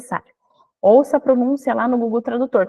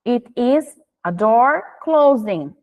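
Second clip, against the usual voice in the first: very slow, clear sentence; slow teaching voice; much slower than usual. Shown as a demonstration. Teacher showing the card, slow and clear. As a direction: say it slowly for a small child. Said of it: "tu pode ensinar através do cartão".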